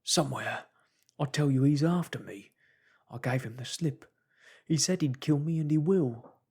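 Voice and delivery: high, loud panicked pleading; a hunted man begging for help; breathless fear of being killed; terrified fugitive. Acted. Marvel pleading in terror.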